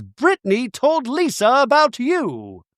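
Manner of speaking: high, slow put-on voice; hammed-up high impression of a gossip; mock tattletale. Imitating a cast member telling Angie of gossip, mock tattletale.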